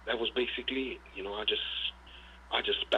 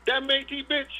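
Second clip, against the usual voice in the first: excited; 12 semitones higher, 5 dB louder than usual. Real, not acted. Boasting about his champion dog.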